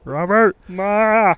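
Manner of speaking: high, loud whiny sitcom dad; Ray Romano. Retold or imitated. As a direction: whine and holler at the family. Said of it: "Jesus, Ray Romano".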